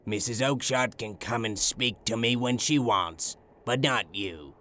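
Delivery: low-pitched, angry telling-off; angry shopkeeper. A narrator's reading. Angry shopkeeper refusing the little man's questions about geese, low-pitched. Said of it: "Breckenridge shouted".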